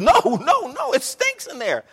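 high, loud mock refusal: no, no, no; mock protest of the crowd. Crowd at Lazarus's tomb refusing to open it, loud mock protest. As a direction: ham up the crowd's disgust at the tomb. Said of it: "and the people said".

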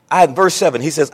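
ordinary talk, nothing marked

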